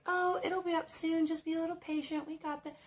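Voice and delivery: high, sweet, fast put-on server voice; fake-nice, way up high and quick; sugary customer-service voice. Server placating an impatient customer in a high, sugary voice. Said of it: "And I'm like".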